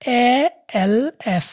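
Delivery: slow spelling. Shown as a demonstration. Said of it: "এলফ".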